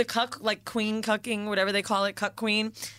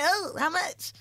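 high mock voice; 8 semitones higher, slower than usual. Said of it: "they was looking at me and my bitch like".

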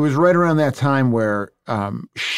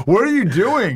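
mock shocked guests; 11 semitones higher, 4 dB louder than usual. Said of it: "People were like".

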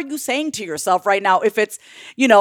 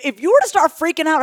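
ordinary talk, nothing marked

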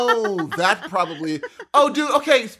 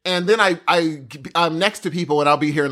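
ordinary talk

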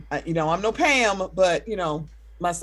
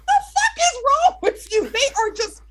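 laughing disbelief; 5 dB louder than usual. Real, not acted. Host shrieks with laughter over the couple. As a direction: cackle it out in disbelief.

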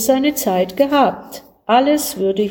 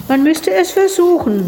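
put-on eager boy; 8 semitones higher than usual.

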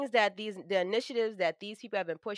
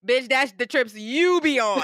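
loud, higher, drawn-out comeback; loud teasing retort; 4 semitones higher, 9 dB louder than usual. Real, not acted. Host denying co-host's claim about girls' trips, loud retort. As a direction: throw it back at them loudly, stretching the words.

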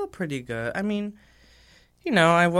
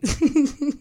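nervous laugh; 11 semitones higher, 4 dB louder than usual. Real, not acted.

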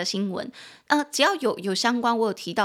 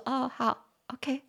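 softer, meek little okay; meek backing down; 3 semitones higher, 7 dB quieter, slower than usual. Retold or imitated. Speaker backing down to the colleague, soft and meek. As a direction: give in quietly. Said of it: "我就说".

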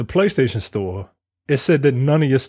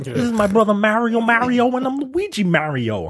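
higher-pitched New York "Mare-io" introduction; movie character introduction; 9 semitones higher than usual. Acted. Introducing the brothers as in the movie, higher acted voice. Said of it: "the way they say it in the movies".

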